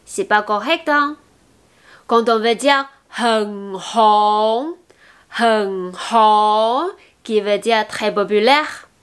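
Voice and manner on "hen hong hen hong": slow "hen hong" with both H sounds breathed; sounded H. Shown as a demonstration. Teacher modelling "very popular", audible H in "hen hong". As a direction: puff an H at the start of "hen" and "hong". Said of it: "n'oubliez pas le H".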